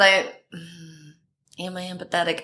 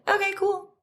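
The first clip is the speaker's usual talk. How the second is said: much higher, softer mock-casual okay; casual agreement; 13 semitones higher, 5 dB quieter than usual. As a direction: toss it off lightly in a high voice. Agreeing to the photo shoot.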